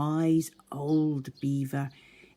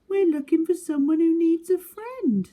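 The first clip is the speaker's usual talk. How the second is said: small high eager animal voice; Little Beaver voice; 13 semitones higher, 8 dB louder than usual. Acted. Little Beaver asking new animals to be friends, high eager voice. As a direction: play a hopeful little beaver, bright and high.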